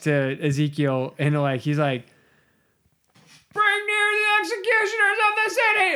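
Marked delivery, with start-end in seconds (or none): 3.5-5.9 high, strained mock shout; over-the-top yelled command; comic booming cry. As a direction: cry it out absurdly high, one long comic holler. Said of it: "cried in my ears with a loud voice".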